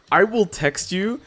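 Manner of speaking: high, slow put-on voice; a hammed-up high whine, drawn out; mock whiny text voice. Mock whiny voice texting friend.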